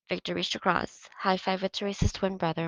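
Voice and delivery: ordinary delivery, nothing marked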